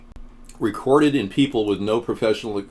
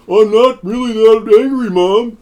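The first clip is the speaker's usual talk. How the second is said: son's voice; 10 semitones higher, 7 dB louder, slower than usual. Retold or imitated. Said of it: "His mom was like".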